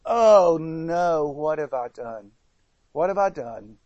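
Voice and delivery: low, sinking voice of dismay; dismayed horror. Retold or imitated. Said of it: "his thought then is".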